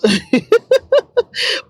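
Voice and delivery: high, extended giggling laugh. Real, not acted. Vlogger admitting she can't mention everything, giggling.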